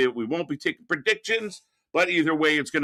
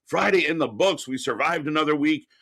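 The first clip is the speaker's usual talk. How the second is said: ordinary talk, nothing marked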